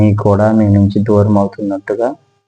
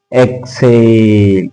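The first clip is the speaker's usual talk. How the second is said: very slow, stretched words, louder on the in-breath; drawn-out breathing cues; 5 semitones higher, 4 dB louder, much slower than usual. Shown as a demonstration. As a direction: stretch each word to last a whole breath. Leading slow breathing along with listeners.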